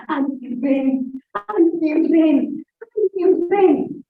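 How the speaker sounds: rousing stage storytelling; dramatic acted running. Shown as a demonstration. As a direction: stretch every "ran", building with each repeat. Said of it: "But what if I did".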